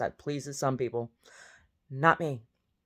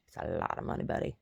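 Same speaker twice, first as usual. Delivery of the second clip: dropped, lower, softer mutter; low sarcastic aside; 8 semitones lower, 6 dB quieter than usual. Acted. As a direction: drop the voice low and dry. Mocking George Santos.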